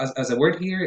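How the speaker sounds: ordinary talk, nothing marked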